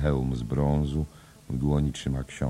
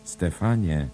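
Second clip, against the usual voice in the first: calling; 5 semitones higher, 4 dB louder, slower than usual. Acted. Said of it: "Woła raz jeszcze".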